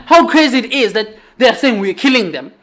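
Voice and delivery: ordinary delivery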